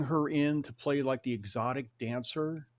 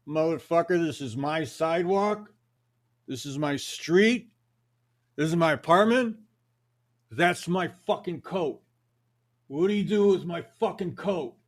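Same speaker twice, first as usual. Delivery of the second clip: loud, higher, menacing street rant; angry threat; 5 semitones higher, 6 dB louder than usual. Retold or imitated. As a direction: get in his face and lay claim to everything. Angry stranger confronting about coat.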